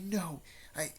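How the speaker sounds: high, pleading disbelief near tears; close to tears. A narrator's reading. Naruto denying Jiraiya's death, close to tears. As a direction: refuse the news, voice lifted and strained. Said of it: "she was mostly more tearing up".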